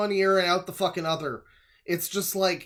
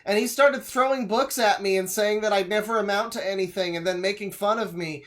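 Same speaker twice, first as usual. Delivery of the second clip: higher, loud whiny student voice; high and fast, like a kid complaining; mock kid voice; 5 semitones higher, 5 dB louder than usual. Voicing the schoolkid character.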